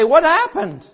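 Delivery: high, loud, stunned question; loud, high shocked cry from people left behind on earth; bewildered cry. Retold or imitated. People waking up after the rapture.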